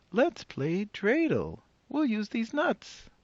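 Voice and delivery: put-on high, bright old granny greeting a guest; old woman voice. Old woman welcoming the rabbi at her door, high and cheery. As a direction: play the cheerful old grandmother.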